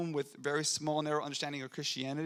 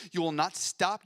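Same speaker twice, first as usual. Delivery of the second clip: ordinary talk, nothing marked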